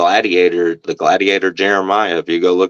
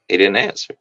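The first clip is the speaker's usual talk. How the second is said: ordinary delivery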